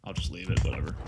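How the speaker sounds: hushed, resigned whisper; quiet throwaway whisper to chat; whispered aside. Real, not acted. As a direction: mutter it under your breath, giving up. Muttered aside about PC.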